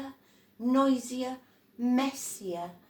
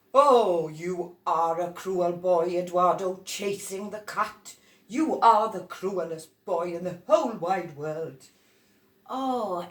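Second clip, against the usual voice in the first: louder scolding adult voice; scolding grown-up; 8 dB louder than usual. Acted. Adult scolding cruel Eduardo, loud and stern. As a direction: tell the boy off for chasing the cat.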